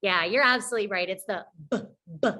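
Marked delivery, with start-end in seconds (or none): 1.7-2.3 "b" said alone as a short letter sound; short, separate "b" sounds with a gap between; isolated B sound. Shown as a demonstration. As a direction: pop out a short "b", not the letter name.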